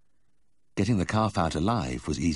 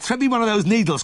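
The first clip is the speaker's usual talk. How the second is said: much higher and louder, frantic; panicked shout; 8 dB louder than usual. A narrator's reading. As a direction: yell for the needle in panic. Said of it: "I shouted".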